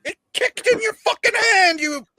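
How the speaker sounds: very high, loud exasperated cry; outraged yell. Pretend. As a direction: shriek it in disbelief at his stupidity. Rant scolding Baldwin in absence.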